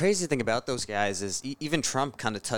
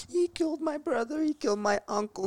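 crying teacher's joy; 10 semitones higher than usual. Retold or imitated. Teacher squeals through tears to class about Fidel. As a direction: squeal it out like she's sobbing with happiness. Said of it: "She's like".

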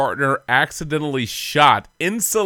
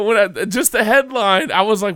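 normal talking voice

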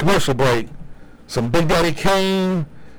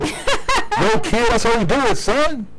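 laughing East Coast greeting; 11 semitones higher, slower than usual. Retold or imitated.

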